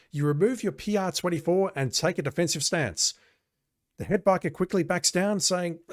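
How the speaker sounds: high-pitched, animated narrator read; game narration. A narrator's reading. Player reading the biker scene aloud, animated story narration.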